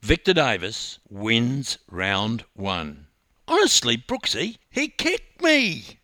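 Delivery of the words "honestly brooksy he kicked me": high, wailing complaint; Peter Evans. A narrator's reading. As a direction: wail in protest to a teammate, drawing the last words out. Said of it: "wailed Peter Evans".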